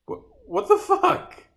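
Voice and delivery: ordinary talk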